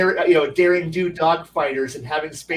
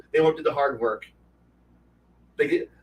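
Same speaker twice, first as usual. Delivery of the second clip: usual speaking voice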